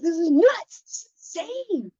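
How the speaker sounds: high, petulant mock tantrum; a spoiled brat venting, stretched out; bratty outburst. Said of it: "my little bratty moment".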